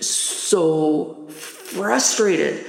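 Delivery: slow, drawn-out "so frustrated"; retold exasperation, stretched out; frustrated emphasis. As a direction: lean hard into each word with exasperation. Acting out mounting childhood frustration.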